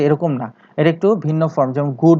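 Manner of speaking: usual speaking voice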